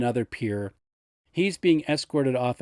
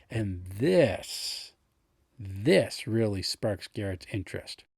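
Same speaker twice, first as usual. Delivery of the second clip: normal talking voice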